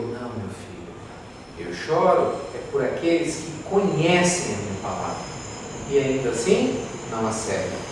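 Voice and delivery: low, soft fatherly answer; hushed, solemn reply; Jesus, tender and grave. Acted. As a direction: answer gently, with quiet sorrow. Jesus answers his son gently.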